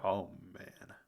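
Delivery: ordinary delivery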